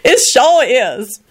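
high, slow, blissful drawl; ecstatic. A narrator's reading. Lucretia arriving at the Palace, ecstatic drawl. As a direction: stretch it out in delight. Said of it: "Lucretia repeated ecstatically".

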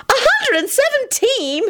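very high, drawn-out shriek; slow, high-pitched incredulous repeat; disbelieving screech. A narrator's reading. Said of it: "Elizabeth screeched disbelievingly".

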